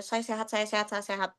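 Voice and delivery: soft muttered self-assurance; quiet self-talk. Retold or imitated. Convincing herself she is healthy, soft self-talk. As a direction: murmur it to convince yourself.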